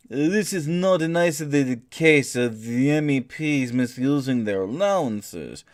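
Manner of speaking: a streamer mocking a quote in a thick French accent; outrageous French accent. Read the quote in an over-the-top French accent. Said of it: "in an outrageous French accent".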